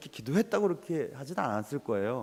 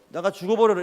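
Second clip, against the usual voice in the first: mock angry yell; 7 dB louder than usual. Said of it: "이렇게 얘기했어요".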